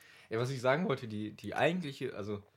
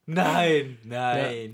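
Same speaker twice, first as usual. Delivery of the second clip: loud laughing no; laughing denial; 8 dB louder than usual. Real, not acted. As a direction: laugh it off in disbelief. Lachende Abwehr.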